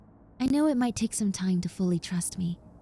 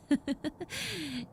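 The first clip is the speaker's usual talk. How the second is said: a much softer, breathy little laugh; soft shy laugh; 6 semitones higher, 8 dB quieter than usual. Acted. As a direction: laugh quietly, a little flustered. Laughs after mentioning special person.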